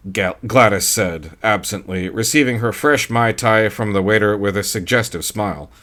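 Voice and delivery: ordinary delivery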